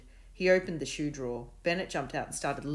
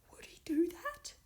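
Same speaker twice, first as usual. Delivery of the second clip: high, breathy whisper; frightened hushed question. A narrator's reading. Scared dog whispering to Davina, hushed. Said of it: "he whispered".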